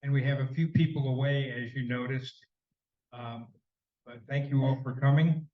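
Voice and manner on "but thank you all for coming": ordinary delivery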